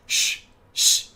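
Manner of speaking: rapid whispered shh-shh; shushing battle. Retold or imitated. Dead people and killer shushing each other in a fight. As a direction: fire shushes back and forth. Victims throwing the shush back.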